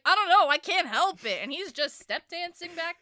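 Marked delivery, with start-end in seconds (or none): 0.0-1.4 higher, louder helpless cry; mock helpless protest. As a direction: blurt it out like you can't stop dancing. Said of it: "And he's like".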